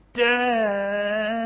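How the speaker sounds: high, drawn-out "Dad" in three syllables; a kid angling for a favour, stretching "Dad" out; wheedling "Dad". Shown as a demonstration. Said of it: "Three syllables".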